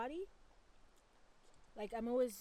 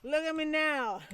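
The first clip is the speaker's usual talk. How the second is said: playful loud teasing; 7 semitones higher, 11 dB louder than usual. Pretend. Mock-boasting about the baby.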